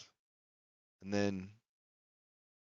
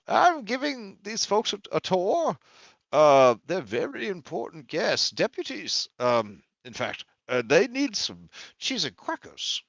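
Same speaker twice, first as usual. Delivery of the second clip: higher-pitched, halting official; Mister Quay, self-important guide; 8 semitones higher than usual. Acted. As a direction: show off important guests, stumbling a little.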